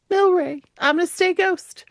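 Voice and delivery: lightly voiced ghost begging his friend; mock-earnest high plea; high pleading voice. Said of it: "he was like".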